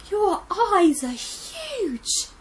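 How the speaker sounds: high and slow, uneasy; slow, wary girl voice. Acted. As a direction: stare and say it slowly. Red frightened by Gran.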